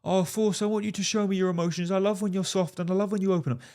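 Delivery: high mock girly voice, quick; mocking girlfriend. Mocking what women say to him, high girly voice. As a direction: mimic a woman asking sweetly for feelings. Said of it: "she may say to you".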